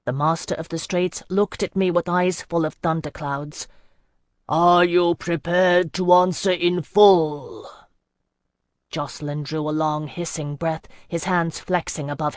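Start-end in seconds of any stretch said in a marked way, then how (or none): 4.5-7.7 slow, grave voice of an ageless sea lord; ancient sea lord. Acted. Sea lord questioning the gathered mortals, slow and grave. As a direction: let each word roll out like the tide. Master of the Straits speaks.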